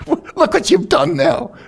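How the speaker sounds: high, loud mock outburst at himself; hammed-up cry of frustration, high and loud; mock angry outburst. Preacher retelling his own rage at the broken windshield, hammed-up high outburst.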